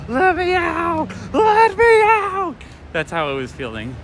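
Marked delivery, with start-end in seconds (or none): 0.1-2.5 very high, slow, stretched "Let me out"; drawn-out baby plea. Retold or imitated. A toy shop owner imitating the baby stuck in the stroller, very high slow wail.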